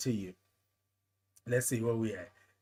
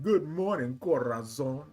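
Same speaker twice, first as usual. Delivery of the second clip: lightly imitated, lower CORAZON; imitating his greeting; 4 semitones lower than usual. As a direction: savour the word Corazon, playfully copying him. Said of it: "I love it when he says it like that".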